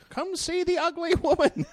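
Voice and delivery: high, sing-song carnival barker call. Acted. Comic pitching his own wife like a sideshow.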